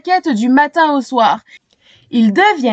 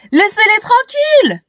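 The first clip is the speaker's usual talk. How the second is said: much higher, desperate yell; Shéna yelling; 9 semitones higher, 3 dB louder than usual. A narrator's reading. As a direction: scream at the crowd to stop. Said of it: "hurle Shéna".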